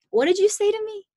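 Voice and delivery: high, challenging street guy demanding an answer; menacing pimp voice. Retold or imitated. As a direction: confront him: what did you say. Pimp confronts Carl in retold story.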